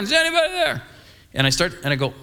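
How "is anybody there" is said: much higher pitched call, straining to carry; yelling again. Retold or imitated. Pastor yelling again into the woods, searching. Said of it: "and I yell again".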